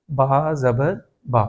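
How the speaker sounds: با زبر با drawn out very slowly; slow spelling demo. Shown as a demonstration. Teacher spelling the second reading of the doubled ba. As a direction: spell out با زبر با, each syllable held. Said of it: "دوسری مرتبہ اس کی اپنی زبر".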